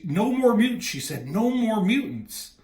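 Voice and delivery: high, loud and urgent, repeating it; stunned frightened outburst; shocked high cry. Retold or imitated. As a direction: cry it out as if haunted by it.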